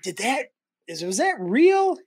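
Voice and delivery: half asleep, not believing it happened; incredulous wonder. Retold or imitated. Waking up, doubting the offer.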